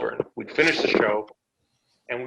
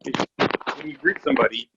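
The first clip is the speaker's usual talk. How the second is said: normal talking voice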